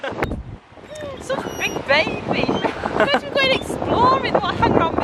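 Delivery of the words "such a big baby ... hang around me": high fond scolding of a puppy; high teasing dog talk. Real, not acted.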